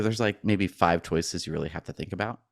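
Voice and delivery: ordinary delivery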